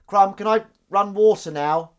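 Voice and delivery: high, loud, slow whining plea; whiny voice. Acted. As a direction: whine the question like a pet. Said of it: "the whiny voice".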